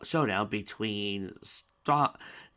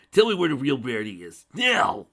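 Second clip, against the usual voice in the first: much louder command, peaking high on now; furious order; 5 semitones higher, 10 dB louder than usual. A narrator's reading. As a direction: order it, snapping on the last word. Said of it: "ordered Spike".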